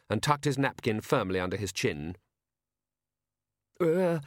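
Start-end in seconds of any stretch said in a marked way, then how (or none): 3.8-4.2 slow, nervous quaver; a hesitant old man's quavering plea. A narrator's reading. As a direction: ask with a timid tremble. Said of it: "he quavered".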